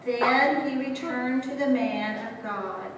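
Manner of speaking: usual speaking voice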